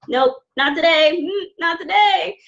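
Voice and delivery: high, mock-dismissive quip; playful refusal. Reader joking to viewers about her daily readings, playfully dismissive. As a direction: turn it down in a high, teasing pitch.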